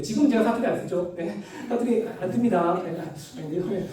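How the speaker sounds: usual speaking voice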